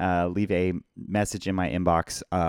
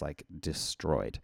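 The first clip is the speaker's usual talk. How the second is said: hoarse voice; 7 semitones lower, 11 dB quieter, slower than usual. Real, not acted. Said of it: "my voice is just".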